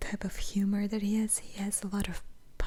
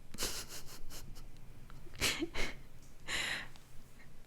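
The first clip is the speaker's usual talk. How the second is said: long breathy giggle; 8 dB quieter than usual. Real, not acted. Long laugh at the joke.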